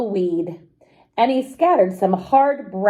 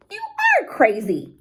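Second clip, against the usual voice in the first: higher, exclaiming wife voice; crying out in disbelief; 13 semitones higher than usual. Acted. Wife telling husband he's crazy, higher disbelieving cry. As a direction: cry it out, she can't believe him. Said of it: "cried Emma".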